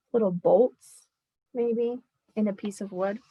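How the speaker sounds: usual speaking voice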